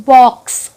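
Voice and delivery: loud, stressed বক্স ending in কস; phonics demo. Shown as a demonstration. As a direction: punch বক্স to show the ks sound. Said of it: "এই যে উচ্চারণটা হচ্ছে". (জোর দিয়ে বক্স)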